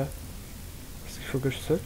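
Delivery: soft, slow, half-whispered musing; hushed. Real, not acted. Player wonders to himself, hushed and slow. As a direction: ask yourself under your breath.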